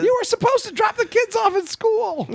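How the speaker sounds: high-pitched mock nagging yell; wife scolding. Wife scolds husband about the kids, high yell.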